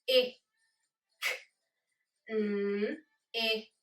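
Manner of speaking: picnic split into separate sounds, a gap after each; sounded out phonemes. Shown as a demonstration. Teacher sounding out a word's phonemes for the class, sound by sound. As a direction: sound out "P, I, K, N, I, K" one phoneme at a time. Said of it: "Tell me the phonemes in the word".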